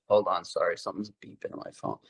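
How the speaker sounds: hushed off-mic interruption; quiet aside. Real, not acted. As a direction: duck away from the mic to deal with the phone. Aside about a beeping phone.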